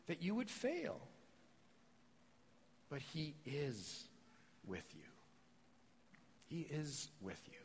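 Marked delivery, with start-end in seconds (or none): 2.9-7.6 hushed promise. Real, not acted. Preacher assures the congregation God is with them, hushed. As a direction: lean in and promise it quietly. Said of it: "preacher's intimate repeated promise".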